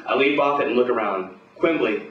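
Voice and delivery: ordinary delivery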